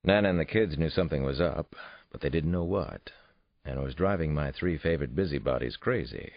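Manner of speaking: ordinary delivery, nothing marked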